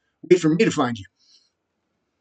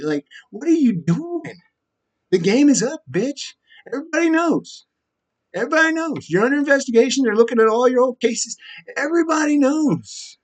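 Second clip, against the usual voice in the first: much higher, jeering rant; shrill taunting; 9 semitones higher than usual. Real, not acted. Taunting Lisa that everybody knows, shrill jeering. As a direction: taunt her, voice shooting way up.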